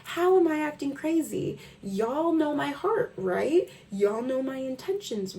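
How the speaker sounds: high, incredulous protest; mock indignant self. Answering gaslighters, high incredulous protest. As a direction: protest your innocence, hammed up. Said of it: "It's like, what?".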